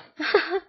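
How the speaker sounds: high bitter scoffing laugh; a scornful little laugh; harsh laugh. Acted. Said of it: "another harsh laugh".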